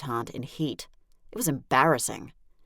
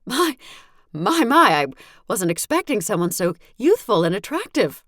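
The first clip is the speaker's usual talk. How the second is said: high, gushing mother voice, much higher and louder; a syrupy, higher mother gushing over a guest; flirty mother; 7 semitones higher, 6 dB louder than usual.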